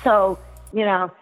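normal talking voice

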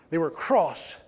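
a prim British "They were cross," with a crisp "cross"; British accent. Shown as a demonstration. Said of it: "You gotta hear the accent in there".

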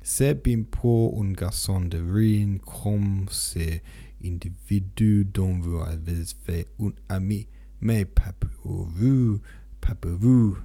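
slow, pointed French lines. Acted. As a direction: lean on the French to weigh each word. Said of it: "he used French".

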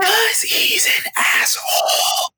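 slow, drawn-out creepy whisper. Pretend. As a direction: hiss it slowly as a joke, all whisper. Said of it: "you do the creepy whisper".

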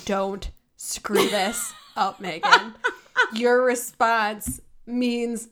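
steady yourself before replying; slow nervous self-talk. Retold or imitated. Retelling telling herself not to blow the reply, slowly. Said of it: "I was like".

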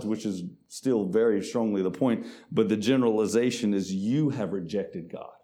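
ordinary talk, nothing marked

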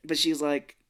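ordinary talk, nothing marked

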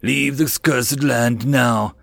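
slow menacing orc warning; Orkin voice. Acted. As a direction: drag the words out like a grim orc. Orkin warns them off.

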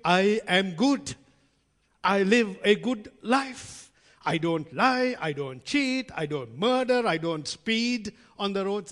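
loud, slow, each claim punched; hammed-up boasting of one's own goodness; proud list of virtues, declaimed; mock self-righteous.

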